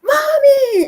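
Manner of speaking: high, loud joyful shout; excited child's call. Retold or imitated. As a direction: yell for mum as you run to her. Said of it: "because she would run".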